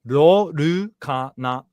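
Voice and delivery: "Rorukana" very slow, every syllable even, R for L; Japanese pronunciation. Shown as a demonstration. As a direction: spell "Rorukana" out slowly, like a teacher. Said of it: "L doesn't exist for the most part here".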